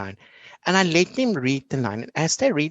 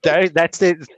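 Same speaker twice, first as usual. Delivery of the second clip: ordinary delivery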